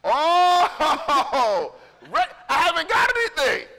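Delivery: laughing. Retold or imitated. Caught without money for the check.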